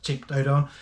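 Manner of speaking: ordinary talk, nothing marked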